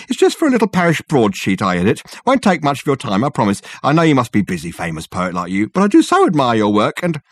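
loud, high and fast, gushing at the door; a fussy visitor talking his way inside. Acted. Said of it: "said in a loud voice".

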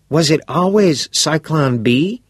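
put-on bright, curious teenage boy; a keen schoolboy pressing for details; eager boy voice. Todd asking.

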